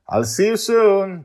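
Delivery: high, loud awkward call upward. A narrator's reading. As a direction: call after someone leaving, a bit awkward. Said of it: "Justin calls out to him awkwardly".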